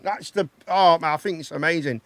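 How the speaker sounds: normal talking voice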